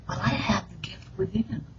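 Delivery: whispered self-talk. Pretend. Inner voice of self-encouragement.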